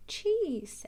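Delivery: a hint of old rabbit voice; lightly voiced grandad; Grampy Rabbit receiving his cheese, lightly voiced. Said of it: "says Grampy Rabbit".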